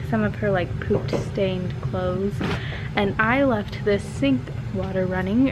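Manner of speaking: ordinary talk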